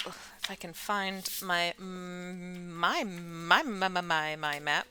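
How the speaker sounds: repeats words, halting stammer; stuttering. Acted. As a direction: stumble over my, my, my. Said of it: "She kinda stutters a bit".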